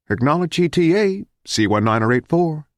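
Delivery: high, rising radio call; bright high-pitched dispatcher. A narrator's reading. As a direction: call for a response like a flight controller. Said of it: "the radio said".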